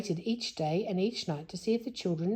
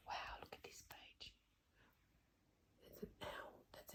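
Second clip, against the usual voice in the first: hushed whispered aside; 26 dB quieter than usual. Real, not acted. Reader whispering to child about the owl picture. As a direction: whisper it like a secret over the page.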